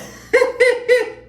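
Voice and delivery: high squealing burst of laughter; a real high-pitched shriek of laughter. Real, not acted. Host cracking up at Heather's line, squealing laugh.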